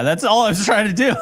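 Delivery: laughing. Real, not acted. Podcast host finally finishes fumbling with the screen, laughing. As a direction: say it while cracking up. Breaks up over own fumbling.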